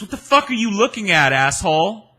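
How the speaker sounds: loud high mock yell; retold yell. Anna Nicole shouting at him on the street, loud mock yell. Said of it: "She goes".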